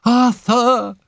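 high, loud anguished call; desperate cry. A narrator's reading. Phyllis flung down on the lifeless body, desperate cry. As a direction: cry out his name in desperation. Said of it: "she cried desperately".

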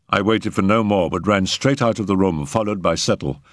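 ordinary delivery

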